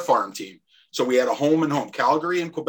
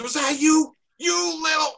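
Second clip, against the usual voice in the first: angry challenge; 14 semitones higher than usual. Retold or imitated. Angry opponent confronting in penalty box.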